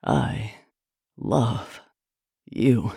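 low words with long gaps. A narrator's reading. Man declares love to his lover, word by word. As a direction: give each word its own beat. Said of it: "he said, a word for each stroke".

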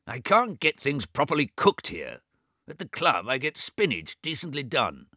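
angrily. A narrator's reading. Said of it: "Swithin Forsyte said angrily".

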